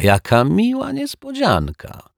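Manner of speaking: high-pitched mock delight; pointedly aloud. A narrator's reading. Detective greets the man he caught, pointedly aloud. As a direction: lift the pitch with exaggerated pleasure. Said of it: "powiedział głośno detektyw Pozytywka".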